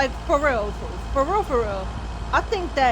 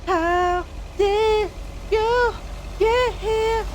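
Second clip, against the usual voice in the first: eerie, very high put-on voice; halting high voice of a startled local; imagined cabin dwellers; 11 semitones higher, much slower than usual. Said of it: "what those people who live there are thinking".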